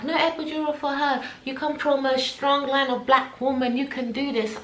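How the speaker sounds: high, firm mum voice. Retold or imitated. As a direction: lay down the law proudly. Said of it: "My mum's like".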